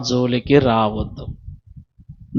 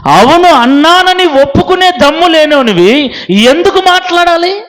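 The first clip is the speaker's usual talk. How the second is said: fiery sermon rebuke; 11 semitones higher, 5 dB louder than usual. Real, not acted. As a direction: thunder at the hypocrite from the pulpit. Preacher rebukes a liar. (ఆగ్రహంతో ఉపదేశం)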